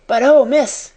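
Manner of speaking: loud, high, urgent appeal; eager cry. A narrator's reading. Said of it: "cried Becky".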